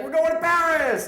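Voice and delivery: high, loud mock-thrilled yell; excited colleague. Colleague announcing the Paris trip to his manager, excited yell. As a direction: whoop it out as an overeager coworker.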